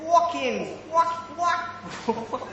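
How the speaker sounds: high American "wawkin"; American accent. Shown as a demonstration. Brit rehearsing the word, high American accent. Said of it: "my best American accent".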